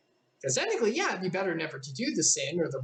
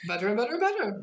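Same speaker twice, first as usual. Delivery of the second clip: getting higher and higher; rising higher; 7 semitones higher, faster than usual. Acted. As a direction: climb higher with each better. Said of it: "voice went higher and higher".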